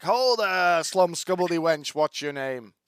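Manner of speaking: loud, high hammed-up Irish bellow; Irish accent. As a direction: storm in and bellow it Irish-style. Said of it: "I don't know why I'm Irish".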